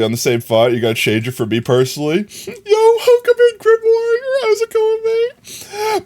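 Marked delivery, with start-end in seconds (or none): 2.5-6.0 very high, playful squeaky hello; put-on greeting voice. Streamer welcoming Grim Warrior to chat, put-on high voice. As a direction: greet them way up in pitch.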